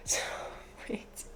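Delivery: soft laughing whisper. Real, not acted. Puzzling over a picture.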